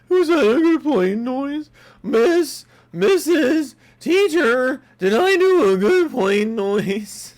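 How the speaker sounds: put-on very high, loud little-kid voice, slow; child asking teacher. A kid asks the teacher about his plane noise, high and whiny. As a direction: whine like a small kid seeking praise.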